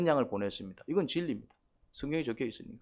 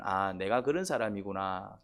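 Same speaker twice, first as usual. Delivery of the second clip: quiet realization; 6 semitones lower, 5 dB quieter, slower than usual. Retold or imitated. Self-realization voiced slowly.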